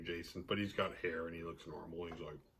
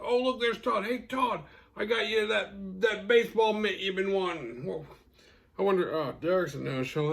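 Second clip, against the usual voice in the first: much higher, gushing mock cheer; high mock delight; 10 semitones higher than usual. Mocking a guy handing Todd a gift, high and gushing. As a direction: gush over a surprise guest.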